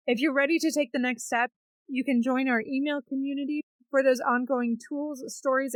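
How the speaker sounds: upbeat promo host voice; polished announcer sign-off; podcast outro read. Acted. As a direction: wrap up the show like an ad.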